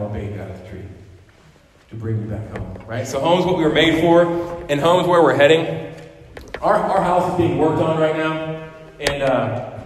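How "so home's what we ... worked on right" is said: loud, higher pulpit declaration; fervent preaching. Acted. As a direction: lift it like a promise. Preaching climax.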